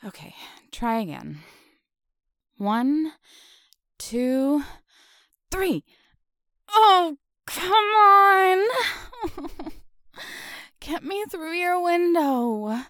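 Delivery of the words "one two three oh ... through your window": very high, loud strained cry; tug of war. Acted. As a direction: yell out as she is yanked. Second pull attempt.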